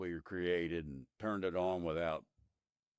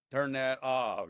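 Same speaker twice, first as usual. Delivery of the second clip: mock yell, raised and higher; retold scream; 6 semitones higher, 5 dB louder, slower than usual. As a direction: call it out like a toned-down scream. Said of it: "I was screaming".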